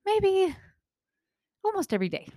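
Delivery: imitating a hesitant, high-pitched concession; hesitant admission. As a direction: admit it reluctantly, with pauses between phrases. Retold client reluctantly admitting more.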